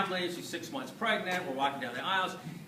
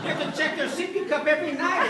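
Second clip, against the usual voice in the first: mock reassuring son; 13 semitones higher, 8 dB louder than usual. Continuing reply to father.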